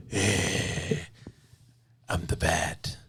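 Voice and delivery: forced low, gravelly superhero voice; Batman. Acted. Host mocks the other Batman actors, voice forced deep. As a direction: push the voice down into a Batman growl. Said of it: "all the other ones just like".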